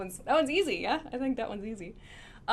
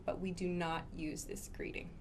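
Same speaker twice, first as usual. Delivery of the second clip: soft whisper aside; 4 semitones lower, 9 dB quieter than usual. Real, not acted. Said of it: "stresses not used".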